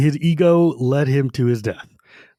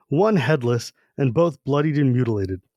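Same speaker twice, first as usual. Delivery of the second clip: normal talking voice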